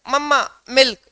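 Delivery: a hint of a small child asking; lightly voiced little kid wanting milk; child's two-word request, mildly acted; light toddler voice. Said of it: "बच्चा कह रहा है".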